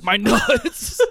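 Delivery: laughing mock cry of pain; a pained squeal broken by a laugh; mock scream. Burglary victim on the floor, laughing mock scream.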